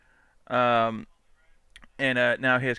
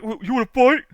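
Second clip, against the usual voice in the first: mock tough guy; 12 semitones higher, 11 dB louder than usual. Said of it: "So it's like".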